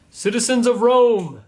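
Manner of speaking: high, slow, loud proclamation; shouting. Acted. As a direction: call it out to the crowd like a herald. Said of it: "he shouted".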